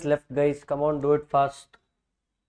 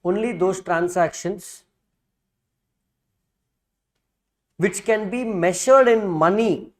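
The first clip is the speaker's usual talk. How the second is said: dictating. Shown as a demonstration. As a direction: read it out phrase by phrase for notes. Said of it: "let me dictate the same thing".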